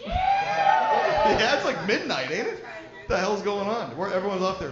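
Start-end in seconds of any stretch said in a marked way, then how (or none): 0.0-1.6 very high, loud whoop; rally the crowd with a wild yell; comic hyping up the crowd for a late night; party whoop. Real, not acted.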